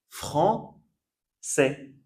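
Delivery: "Français" split as fran, sè; soft C. Shown as a demonstration. Teacher showing learners the word with cédille, soft C. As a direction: say "Français" in two slow parts, with an S. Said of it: "ça change le son".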